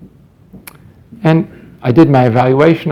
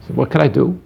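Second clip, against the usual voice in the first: normal talking voice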